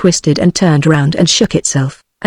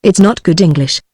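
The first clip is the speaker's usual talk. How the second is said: ordinary delivery, nothing marked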